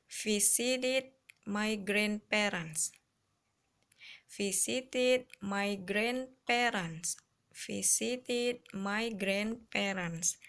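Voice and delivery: slow, careful reading to repeat; teacher drilling a phrase slowly. Shown as a demonstration. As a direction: model it slowly for the class. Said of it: "diulang-ulang dan diingat ya".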